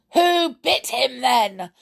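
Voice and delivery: high, loud, slow angry question; fierce demand. A narrator's reading. Husband turning fiercely on his wife, demanding. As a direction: round on her with the question. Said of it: "demanded Mister Lenoir".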